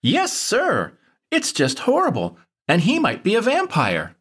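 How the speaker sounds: put-on high, earnest kid; Vinny's voice. A narrator's reading. Vinny warns the farmer about a vampire, high anxious kid. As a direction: add it anxiously. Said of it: "Vinny added".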